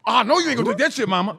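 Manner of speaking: put-on high, loud, fast kids' warning; kids egging on. Kids egging Mama on after the insult, high and loud. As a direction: hype Mama up like a kid. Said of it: "We go, like this".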